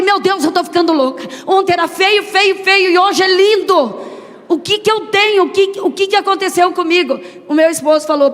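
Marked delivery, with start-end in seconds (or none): 0.0-7.2 higher, louder, tearful astonishment; a higher, louder voice, stunned by joy; overwhelmed disbelief. Retold or imitated. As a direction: marvel as if losing your mind with joy. Mother overwhelmed seeing baby beautiful.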